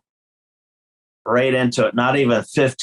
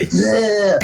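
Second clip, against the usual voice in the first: disgusted bleh; 8 semitones higher than usual. Retold or imitated. Imitating disgust behind the amp.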